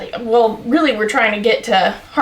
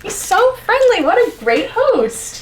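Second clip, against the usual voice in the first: lightly voiced pleased Nicholas; 11 semitones higher than usual. Nicholas praising the host.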